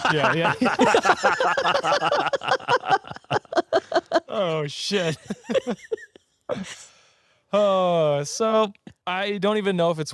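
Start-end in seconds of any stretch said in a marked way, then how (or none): 0.6-4.2 long, high, loud helpless laugh; laughing fit. Real, not acted. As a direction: crack up and can't stop. Loses it laughing.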